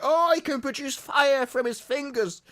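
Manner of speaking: high-voiced awestruck believer, played for laughs; mock amazement. Onlooker fooled by a self-declared magician, mock amazement.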